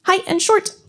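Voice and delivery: said high and quick; high and short. Shown as a demonstration. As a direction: squeak the words high and short. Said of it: "Would Murgatroyd the mouse sound".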